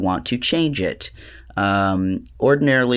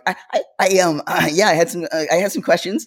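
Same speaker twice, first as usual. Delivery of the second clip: much higher, stammering and unsure; high stammering phone voice; 8 semitones higher, 3 dB louder, faster than usual. Retold or imitated. Mal imitating herself stammering to the clerk, much higher. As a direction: stumble over every word, pitched up. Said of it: "I'm like".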